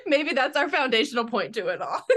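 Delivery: talking through a real laugh; amused, giggly delivery. Real, not acted. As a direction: let the laugh run through the words. Laughs through her own joke.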